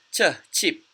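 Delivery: "ch" alone, then "chip"; teacher modelling "ch, chip" piece by piece; ch sound demo. Shown as a demonstration. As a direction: isolate the "ch" before saying "chip". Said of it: "And this one is chip".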